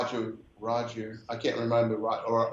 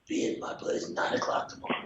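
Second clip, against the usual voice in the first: raspy whispered voice, hushed and breathy; faster than usual. Retold or imitated. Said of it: "You do a great imitation of [name]".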